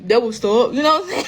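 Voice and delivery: mock someone's way of talking; lightly put-on mimicry, a touch of laughter; mocking imitation. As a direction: imitate her making fun of an accent. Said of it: "she started mocking the way I talk".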